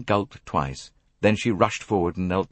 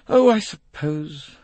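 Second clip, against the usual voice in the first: slow, loud, higher huff; grudging; 10 semitones higher, 6 dB louder, slower than usual. Acted. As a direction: give in sulkily with a big sigh. Sulky girl grudgingly gives in.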